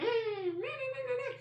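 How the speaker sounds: high-pitched squealing modem imitation; imitated dial-up noise. Explaining the old house computer, imitated dial-up screech. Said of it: "It was like".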